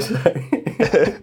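a high, forced nervous laugh; a high strained laugh; tense laugh. Acted. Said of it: "my next line is a laugh".

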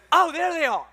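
high, loud sudden realization; exclaimed discovery. Acted. Husband spotting his keys after wife points, exaggerated surprise. Acted relief at finding keys.

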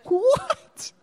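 high, rising disbelief; stunned what. Acted. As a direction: gasp it out in amazement. Reacting to Jesus vanishing.

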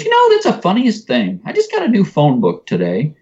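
high, chirpy imitation of mom; mom's voice. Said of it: "And she goes".

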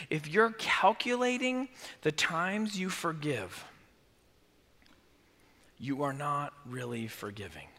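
soft and low for weight; hushed point. Real, not acted. Pastor telling the church softly. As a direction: let the point land quietly.

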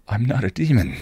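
ordinary delivery

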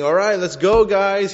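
loud call to arms. Retold or imitated. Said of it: "he's not saying".

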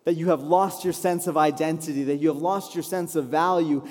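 ordinary talk, nothing marked

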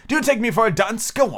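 high, sharp and impatient royal voice; sharp king. Acted. King sharply scolds Hatter, high and impatient. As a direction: snap at him like an irritated king. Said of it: "the king said sharply".

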